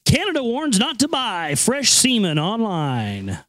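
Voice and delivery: drawn-out, emphatic headline delivery; mock headline read. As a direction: read out a silly headline with relish. Reads out a ridiculous news headline.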